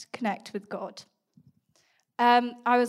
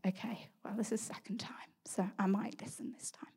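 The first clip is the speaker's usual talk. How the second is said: softer whispered thought; whispered reply; 12 dB quieter than usual. Retold or imitated. Answering God's voice on the run, whispered. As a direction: whisper it, giving in.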